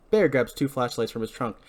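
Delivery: ordinary delivery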